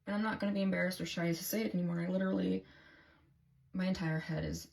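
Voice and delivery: ordinary talk, nothing marked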